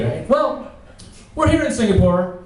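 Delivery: ordinary talk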